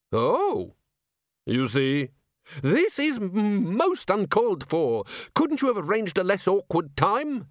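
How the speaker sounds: prim, outraged curator; squeaky fussy curator. Acted. Curator complaining.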